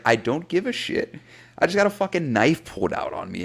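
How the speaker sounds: exasperated. Retold or imitated. Teen retelling his reply to his ex about the knife, exasperated. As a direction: throw it back at her, fed up. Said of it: "I was like".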